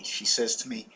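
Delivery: ordinary talk, nothing marked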